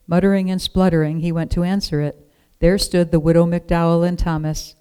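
ordinary delivery

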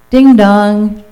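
loud, high ding-dong chime; doorbell imitation. A narrator's reading. Pizza arrives at the farmhouse, voiced doorbell chime.

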